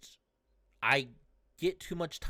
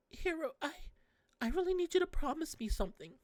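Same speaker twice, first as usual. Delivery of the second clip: [name] pleading; 12 semitones higher than usual. Acted. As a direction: beg him gently in a high voice.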